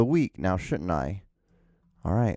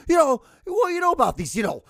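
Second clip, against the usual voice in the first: amped up; 4 semitones higher than usual. Retold or imitated. Podcaster re-enacts ranting around the house, amped up. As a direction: burst into a high-pitched agitated rant. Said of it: "getting amped up like I am now".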